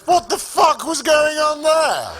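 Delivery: high, loud and slow, laughing through it; incredulous laughing shout; laughing outburst. Real, not acted. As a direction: blurt it out in disbelief. Comic's outburst at absurd news.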